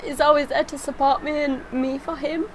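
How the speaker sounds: choked-up and tearful, voice climbing high; fighting back tears while thanking her husband; breaking into tearful little laughs; emotional. Real, not acted. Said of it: "no wonder she becomes so emotional".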